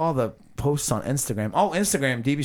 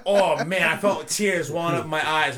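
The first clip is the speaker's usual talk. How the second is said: usual speaking voice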